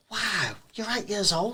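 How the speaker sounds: slow, drawn-out wow of disbelief; amazed disbelief. Retold or imitated. Telling the eight-year-old fan he's amazed, slow and stunned. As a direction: stretch it out, stunned by the little kid. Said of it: "And I was like".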